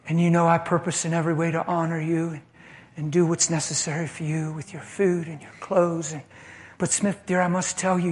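calm, tender firmness, fading soft; wife speaking sweetly to her husband. Retold or imitated. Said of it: "She smiled sweetly and she said".

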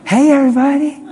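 high, loud fake-happy greeting; mock cheerful holler; phony cheer. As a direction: put on an over-bright hello. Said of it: "That's, that's phony".

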